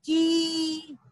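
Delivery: ची held long on one note; long vowel. Shown as a demonstration. Teacher giving the pronunciation to children, long-held ची. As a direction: sustain the ee of ची. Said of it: "उसका उच्चारण होगा".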